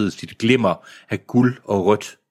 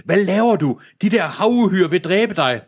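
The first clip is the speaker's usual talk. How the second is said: agitated call; 9 semitones higher than usual. A narrator's reading. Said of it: "råbte Helen ophidset".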